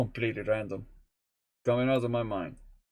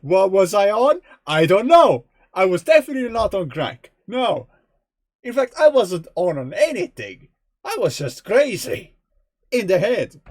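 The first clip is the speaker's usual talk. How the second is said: much louder and higher, amped up; real laughing-fired yelling at himself; loud excited rant; 7 semitones higher, 9 dB louder than usual. Real, not acted. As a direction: shout it like a wild confession. Loud rant about gaming marathons.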